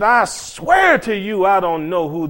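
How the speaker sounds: high, drawn-out oath; vehement Peter. Acted. Peter denying Jesus to an accuser, vehement and drawn out. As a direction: swear it slowly and emphatically. Preacher voices Peter's denial.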